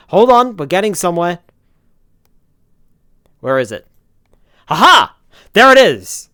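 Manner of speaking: high, loud triumphant shout; aha discovery. Real, not acted. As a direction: cry out in triumph. Finds setting after long search.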